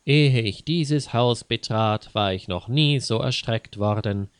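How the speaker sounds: usual speaking voice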